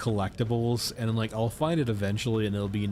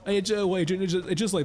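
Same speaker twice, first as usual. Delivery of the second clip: calling out; 9 semitones higher than usual. Real, not acted. Calling out to teammate.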